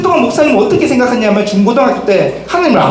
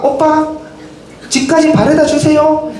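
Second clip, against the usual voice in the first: put-on higher, sweet coaxing girlfriend voice; girlfriend's coaxing plea; 7 semitones higher than usual. Girlfriend begs boyfriend to walk her home, coaxing and high. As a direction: plead with her boyfriend, pitched well up.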